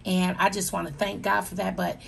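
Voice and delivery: ordinary delivery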